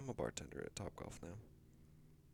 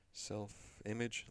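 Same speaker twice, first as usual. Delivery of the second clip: slow, searching; much slower than usual. Real, not acted. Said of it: "what is it, diagnosis?".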